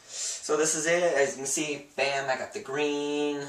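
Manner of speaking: ordinary talk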